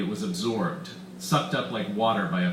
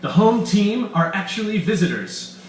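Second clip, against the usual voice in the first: high, loud gleeful villain; delighted; 9 semitones higher, 8 dB louder than usual. Acted. Weiss revealing his alien team to Bull, gleeful and loud. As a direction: relish the reveal. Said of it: "nodding in delight".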